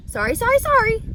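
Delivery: high, loud honking goose. A narrator's reading. Goose to Wilbur, refusing in a high honking voice. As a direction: squawk it like a flustered goose. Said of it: "said the goose".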